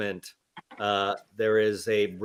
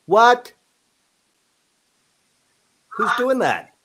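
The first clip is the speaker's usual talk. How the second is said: startled; 11 semitones higher, 7 dB louder, slower than usual. Real, not acted. Startled by a strange voice.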